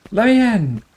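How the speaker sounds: high, loud cry to be let in; desperate shout. Acted. Game character pounding to get in, shouting. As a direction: yell it at the locked door.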